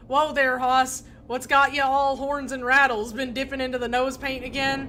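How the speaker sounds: loud, folksy cowboy talk; a loud hint of a Wild West cowboy; cowboy drawl. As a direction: play an old Western sidekick. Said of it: "Gabby doesn't talk like that".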